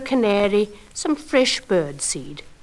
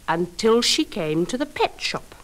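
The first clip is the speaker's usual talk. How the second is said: ordinary delivery, nothing marked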